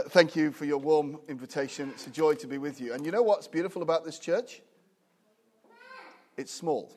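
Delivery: ordinary talk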